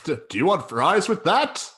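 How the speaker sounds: stilted mock Canadian. Drive-through worker asking about fries, stilted Canadian accent. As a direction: drag it out as a stilted Canadian server. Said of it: "very stilted and very".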